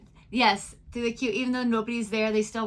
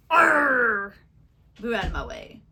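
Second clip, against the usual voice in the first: mock pirate "Arr!" louder, then a lightly voiced shove; pirate growl; 6 dB louder, slower than usual. Joking how British passengers board: mild mock pirate. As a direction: ham up a pirate barging past, lightly. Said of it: "are mostly pirates".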